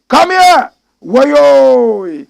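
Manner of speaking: loud, high hype shout; crowd-pumping yell; shouted catchphrase. Acted. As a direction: yell it out like a rallying cry.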